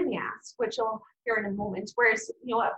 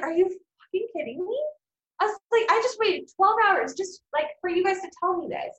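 outraged; 8 semitones higher than usual. Retold or imitated. Patient bumped after twelve hours, outraged at the doctor. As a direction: let the outrage burst out at the doctor.

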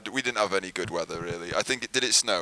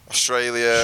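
put-on Australian accent; 4 dB louder, slower than usual. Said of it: "worst Australian accent I've ever done".

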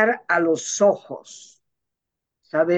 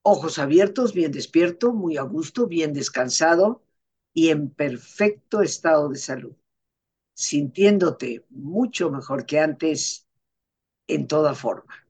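sleep story narrator; 2 semitones lower than usual. Acted. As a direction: ease them gently back awake. Guides listeners through relaxation.

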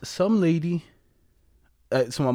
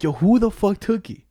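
half-hearted mock yell, higher; retold yelling; 6 semitones higher, 4 dB louder than usual. Grandma yelling at everybody over stolen money, mild mock yell. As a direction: call out accusingly at the whole family. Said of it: "she was yelling at everybody".

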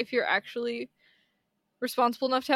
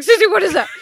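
loud, higher-pitched jumpy cry; mock hysterical; 8 semitones higher, 14 dB louder, faster than usual. Co-host imitating own fright when walking at night, mock hysterical. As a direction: play up a startled, panicked question. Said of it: "I become hysterical right away".

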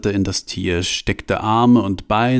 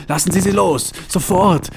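furious shout; 10 semitones higher than usual. Acted. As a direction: scream it at his throat. Franz grabs Ratzlaff's throat.

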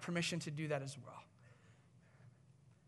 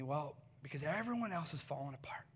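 whispered confession; 5 semitones lower, 7 dB quieter than usual. Retold or imitated. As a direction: whisper the reason, almost unvoiced. Family member's confession.

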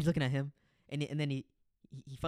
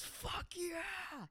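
much higher, softer replay of the yell; a quieter echo of his cheer; hyped; 7 dB quieter than usual. Retold or imitated. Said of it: "He just goes".

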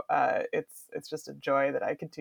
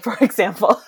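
laughing; 9 semitones higher, 12 dB louder than usual. Real, not acted. Joke about bear-giving staff.

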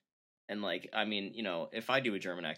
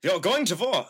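put-on loud, high German-accented shout; German war cry; 8 semitones higher, 9 dB louder than usual. Mock German war cry repeated.

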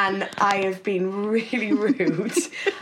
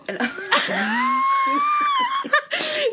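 long, very high squeal of laughter; uncontrolled shrieking giggles. Real, not acted. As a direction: burst out in a helpless high cackle. Cracks up at failed accent.

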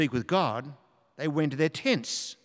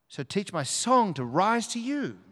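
higher, swooping emphasis on "song" and "rise"; lifted recitation; 7 semitones higher than usual. Shown as a demonstration. Preacher repeats the song line to God, lifted and emphatic. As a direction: lift the lyric upward, pitch well raised.

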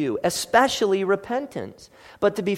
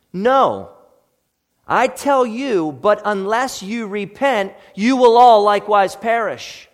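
eternal seriousness; 4 semitones higher, 7 dB louder than usual. Acted. Preacher voicing Jesus's warning, raised and grave. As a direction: proclaim it like a life-or-death warning. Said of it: "with eternal seriousness".

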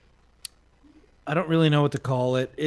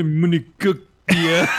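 mock French; 3 dB louder than usual. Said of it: "It's a French".

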